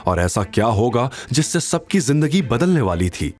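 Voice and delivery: normal talking voice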